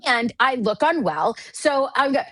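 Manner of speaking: normal talking voice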